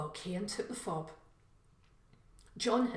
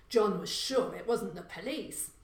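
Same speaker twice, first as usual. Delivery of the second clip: plain reading voice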